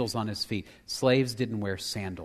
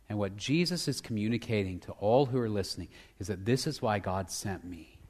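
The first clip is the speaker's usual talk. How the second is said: ordinary talk, nothing marked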